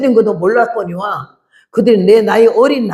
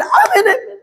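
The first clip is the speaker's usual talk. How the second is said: very high-pitched imitated amens; high, frenzied crowd imitation; mock congregation uproar; 12 semitones higher than usual. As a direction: mimic worshippers in an uproar. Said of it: "난리가 났어".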